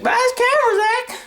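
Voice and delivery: squeaky-high little urging voice; acted self-urging. Talking himself into buying a camera, squeaky high urging. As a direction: urge in a very high put-on voice. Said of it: "I was like".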